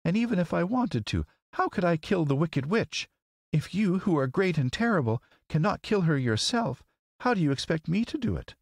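put-on high, plaintive little girl; mild tearful protest. A narrator's reading. Girl refusing to kill the witch, mildly tearful. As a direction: plead tearfully, pitched high without real sobs. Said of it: "she sobbed".

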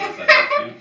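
high squealing laugh; laughing squeak. Real, not acted. Laughing at the idea.

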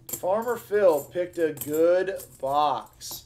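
half-speed, drawn-out announcement; slow, savoring repeat. Real, not acted. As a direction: stretch each word with relish. Repeats it slowly for effect.

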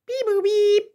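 high robotic beeping; squeaky alien beep-boop. Pretend. Alien noises in story.

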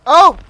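loud, high "oh"; startled cry. Real, not acted. Player who just killed a man, loud startled cry. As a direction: exclaim in sudden shock.

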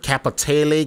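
Capitale said as "kappa-TAY-lee"; variant pronunciation. Shown as a demonstration. Warning viewers about a much-heard word, variant pronunciation. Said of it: "however you wanna pronounce it".

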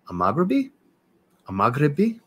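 Amaghribi said high with a questioning rise; unsure pronunciation. Real, not acted. Streamer attempting a fragrance name for viewers, unsure pronunciation. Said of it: "not saying it with the right twang".

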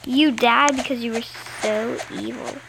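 usual speaking voice

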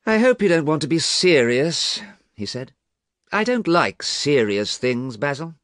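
put-on light, teasing young man; Dorian's voice. A narrator's reading. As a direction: brush off the seriousness lightly.

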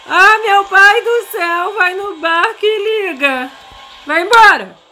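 high, loud panicked shrieks; being chased. Real, not acted. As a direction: scream it while fleeing. Granny chasing her to boat.

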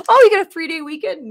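laughing. Real, not acted. Reacting to viewer chat.

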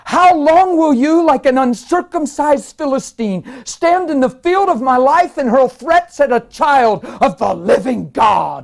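slow, high, thundering preacher's challenge; spirit man's roar. Retold or imitated. Man challenging the demonic as a Philistine, roaring. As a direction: hurl it out drawn-out and loud. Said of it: "This was my spirit man".

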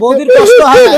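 high, loud urgent plea. Real, not acted. Calling over a weeping father, high and loud. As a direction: cry out to make him stop. Urging crying man to stop.

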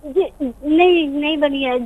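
normal talking voice

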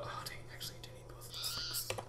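whispered aside to himself. Real, not acted. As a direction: whisper it under your breath. Realizes mistake, whispers.

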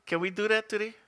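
usual speaking voice

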